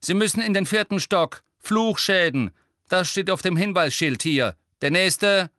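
loud, high, impatient directions; grumpy. A narrator's reading. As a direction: dismiss the patient and summon the next. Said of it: "deutete missgelaunt". (missgelaunt und laut abgefertigt)

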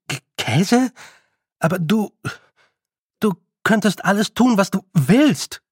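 flustered, high-pitched outburst; blurted disbelief. A narrator's reading. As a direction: burst out incredulous, stumbling, then stretch the last words. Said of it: "entfuhr es Roland".

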